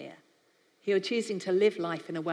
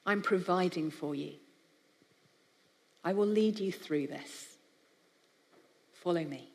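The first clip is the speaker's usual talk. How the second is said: tender, gentle God; 2 semitones lower than usual. Pretend. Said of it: "It's a very different tone of voice".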